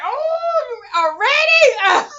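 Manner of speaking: screaming in disbelief; loud, high and stunned; shocked shout. Retold or imitated. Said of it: "And I'm like".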